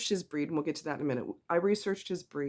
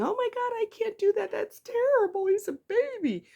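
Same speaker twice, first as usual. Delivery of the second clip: shrill mock gasp of a doting owner, far higher; 4 dB louder than usual. Mocking the owner who babies the puppy, high squeal. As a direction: parody the horrified pet parent, way up high. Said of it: "not think of your puppy as a little human being".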